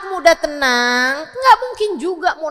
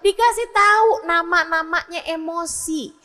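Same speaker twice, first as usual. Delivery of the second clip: ordinary talk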